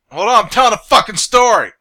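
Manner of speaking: yelled at full volume, annoyed; angry shout. Real, not acted. Interrupted mid-story, snaps back.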